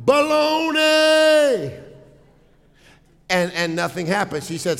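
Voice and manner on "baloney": high, loud, long-drawn yell; mock shout. Wife testing the Grand Canyon echo, high drawn-out mock shout. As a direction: holler it out across a canyon, stretched long. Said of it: "And she shouted".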